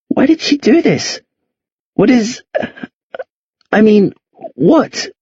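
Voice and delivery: usual speaking voice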